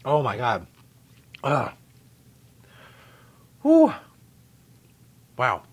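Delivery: gasping after hot dip; mouth burning from spicy food. Real, not acted. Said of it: "the back of my throat is on fire".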